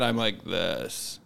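low creaky vocal fry. Shown as a demonstration. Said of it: "I need to stop speaking with vocal fry".

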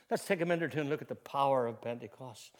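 ordinary delivery

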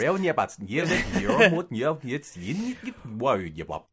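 garbled reversed-tape gibberish; mock backwards speech. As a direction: babble as if the words run backwards.

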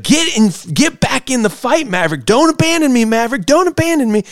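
high, pleading yell. Retold or imitated. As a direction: shout it up high, begging not to be left. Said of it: "Ice is yelling at him".

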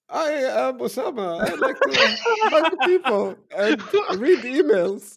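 high, slow, old lady impression of a friend. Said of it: "I wanna hear an Osama impression now".

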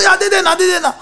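high, loud insisting; mock conductor insisting loudly; conductor's insistence. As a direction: shout back the fare. Conductor's reply in retold haggle.

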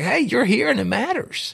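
high-pitched, eager greeting; enthusiastic welcome. Acted. Host treating a visitor as if they matter, enthusiastic. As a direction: lift the pitch high to make them feel they count. Models how to greet each other.

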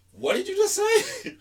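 very high, slow, laughing squeal; mock disbelief. Hearing family say hot dog oddly, squealing laughing disbelief.

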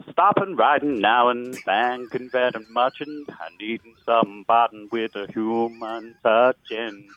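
mock Swedish accent. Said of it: "let's hear the Swedish Spice Girls".

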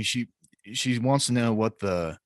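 ordinary talk

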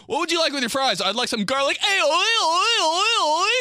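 high, loud goofy "oi"; mock silly character. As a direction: ham it up. Silly restaurant skit.

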